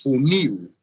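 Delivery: "Funil" with firm -NIL, falling tune; stress on last syllable. Shown as a demonstration. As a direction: demonstrate "Funil" as foo-NEEW. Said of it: "a sílaba forte é a última sílaba".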